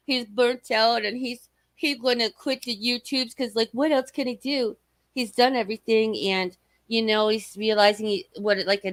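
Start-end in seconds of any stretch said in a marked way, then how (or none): none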